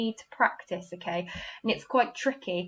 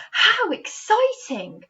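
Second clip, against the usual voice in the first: much higher, slow, thrilled gasp of joy; excited exclamation; 12 semitones higher, 7 dB louder, slower than usual. Shown as a demonstration. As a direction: show the emotion big.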